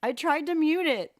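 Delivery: loud apologetic repeat; loud repeat of the apology; flustered again. Real, not acted. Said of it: "Sorry, guys".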